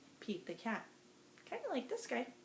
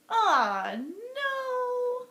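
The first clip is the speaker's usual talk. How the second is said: mock dismay; 12 semitones higher, 9 dB louder than usual. Last button gone.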